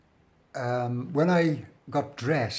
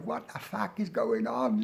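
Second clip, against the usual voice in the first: put-on London accent; 3 dB quieter than usual. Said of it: "London accent".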